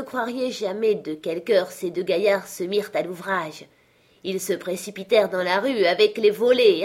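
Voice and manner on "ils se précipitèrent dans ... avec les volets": plain reading voice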